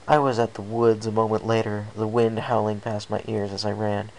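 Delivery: ordinary delivery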